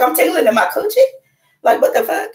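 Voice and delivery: high, loud, startled squeal; shocked disbelief. Retold or imitated. Retelling a sudden tingle to the twins, high shocked squeal. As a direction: yelp it out in total shock. Body tingling during spiritual experience.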